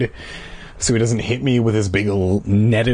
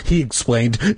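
ordinary delivery, nothing marked

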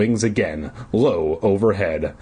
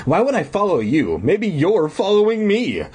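higher-pitched playful retort; teasing; 10 semitones higher than usual. Acted. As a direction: tease back with a climbing tune. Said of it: "the woman teased back".

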